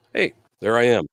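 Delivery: normal talking voice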